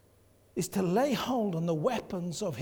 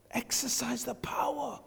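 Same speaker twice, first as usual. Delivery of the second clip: whisper; 5 semitones higher than usual. Real, not acted. Preacher repeats phrase as whisper.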